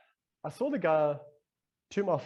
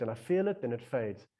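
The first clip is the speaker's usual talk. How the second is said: usual speaking voice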